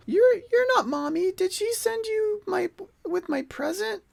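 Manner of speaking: put-on very high little-kid orc voice; teary orc child. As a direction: plead like a small child close to tears. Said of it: "Kinda with teary eyes".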